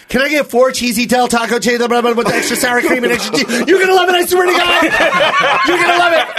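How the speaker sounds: high, excited run-on ordering; insistent friend. Retold or imitated. Imitating his friend at the drive-through, high insistence. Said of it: "he's doing that whole thing".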